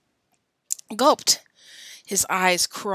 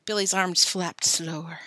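ordinary delivery

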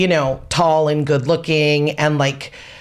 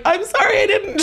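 much higher, teary, laughing apology; flustered apology. Retold or imitated. Explaining herself to mom after crying, high and flustered. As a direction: stammer it out, upset and sorry. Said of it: "And I was like".

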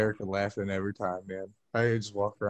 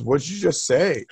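retold puzzled man; 5 semitones higher, 9 dB louder than usual. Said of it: "He goes".

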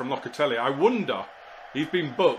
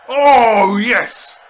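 much higher and louder, a roaring "yes"; goal celebration; 9 semitones higher, 15 dB louder than usual. Real, not acted. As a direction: erupt as the ball flies in. His team scores a screamer.

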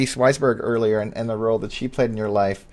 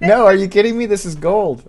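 loud, higher burst of delight; excited; 9 semitones higher, 6 dB louder than usual. Real, not acted. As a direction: shout it out, thrilled by what you see. Host excited by her written notes.

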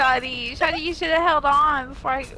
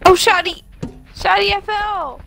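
playful wail; 5 semitones higher than usual. Pretend. Player tells partner they fell, mock wailing. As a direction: whine it out after a fall. Wails after falling in the game.

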